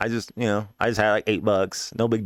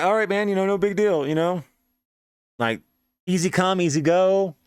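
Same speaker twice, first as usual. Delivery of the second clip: upbeat consoling; 5 semitones higher than usual. Retold or imitated. Cheering up the jilted friend.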